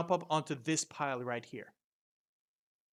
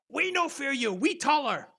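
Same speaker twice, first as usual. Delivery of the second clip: high, loud goblin voice; goblin horde; 10 semitones higher, 7 dB louder than usual. Acted. Stacked goblins defying the adventurers, high and loud. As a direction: shout it high in broken speech. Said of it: "move forward and say".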